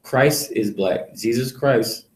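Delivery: ordinary talk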